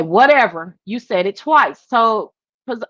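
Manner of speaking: ordinary talk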